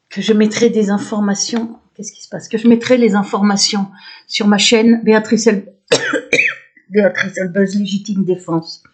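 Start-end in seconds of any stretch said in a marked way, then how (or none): none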